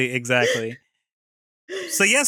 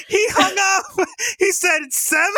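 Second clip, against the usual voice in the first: high, squeaky laughing retelling; hysterical, stretched words breaking into laughs; cracking up. Retold or imitated. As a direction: wheeze it out through helpless laughter. Retelling friends' reaction, cracking up.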